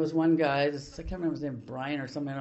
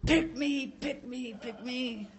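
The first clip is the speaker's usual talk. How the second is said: high, eager pleading to be picked; 8 semitones higher, 5 dB quieter, much slower than usual. Retold or imitated. Recalling the meeting, pleading eagerly to be picked. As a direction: beg to be chosen like a kid in class.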